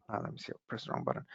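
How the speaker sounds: fast, hushed, under the breath; hurried whisper-like mutter after pressing the wrong button; quiet muttered aside. Real, not acted. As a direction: mumble it to yourself while fixing a mistake.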